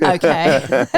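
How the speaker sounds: a laugh bursting through one word. Real, not acted. Host laughing at the panel's running catchphrase, laughing. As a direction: laugh it out, tickled.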